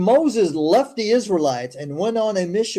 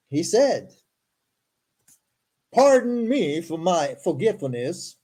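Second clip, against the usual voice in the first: formal, deliberate read-aloud; scripture reading; 2 semitones higher than usual. Acted. Host reading Moses's story to listeners, formal recital. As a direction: recite the verses with careful emphasis.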